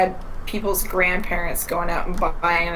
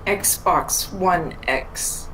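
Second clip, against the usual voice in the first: drawn out; much slower than usual. Real, not acted. Mocking a bad console name.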